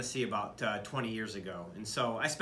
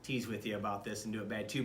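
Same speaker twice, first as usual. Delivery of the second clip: ordinary talk, nothing marked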